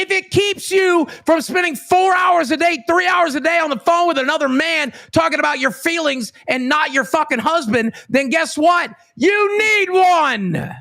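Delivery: shouting. Real, not acted. Heated rant at Glammy.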